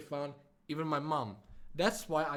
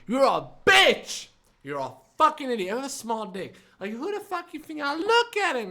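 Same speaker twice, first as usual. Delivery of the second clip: angry shouting; 9 semitones higher, 7 dB louder than usual. Retold or imitated. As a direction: yell the insults at her. Retold shouting insults in quarrel.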